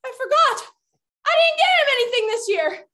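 high, loud cry of dismay; dismayed outburst. Pretend. Cat realizing she forgot the gift, dismayed outburst. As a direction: blurt out the awful realization.

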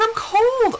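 high mock whine; imitated complaint. Wife imitating cold husband, mock whine. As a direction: whine it as the put-upon husband.

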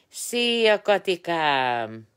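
high, loud, slow drawn-out hello; delighted greeting. Real, not acted. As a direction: call out to a friend with joy. Calling out a greeting to Kati.

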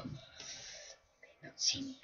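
slow sneaky whisper. Real, not acted. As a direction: whisper so they can't hear. Hiding while invisible in game.

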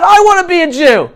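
ordinary talk

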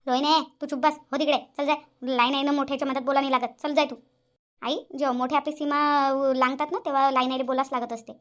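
normal talking voice

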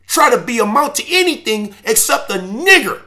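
high, loud mocking threat that builds to a shout; menacing oppressor threat. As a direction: bark it like the men beating him in front of his kids.

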